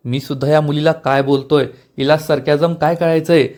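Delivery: plain reading voice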